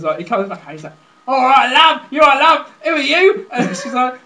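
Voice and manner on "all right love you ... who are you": loud, higher mock southern English accent; southern English bloke. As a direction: call out as a cheeky southern English husband. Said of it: "It's down south what happens".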